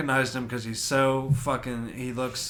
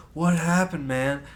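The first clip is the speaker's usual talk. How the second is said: concerned bystander question; 5 semitones higher, 4 dB louder, slower than usual. Retold or imitated. Bystander asks medic about victim.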